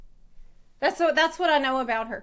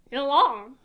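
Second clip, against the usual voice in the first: louder, emphatic French-style "Yelan"; French-sounding try; 4 dB louder than usual. Shown as a demonstration. Streamer testing if the name sounds French, French-style accent. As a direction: say "Yelan" with a put-on French lilt. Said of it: "It sounds, yeah, it sounds".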